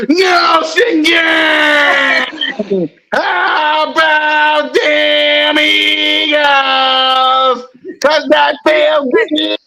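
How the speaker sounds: slow, high, stretched yell; drawn-out hype yell. Real, not acted. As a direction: hype the crowd up. Hype chant on the show.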